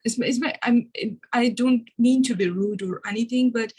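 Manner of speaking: ordinary talk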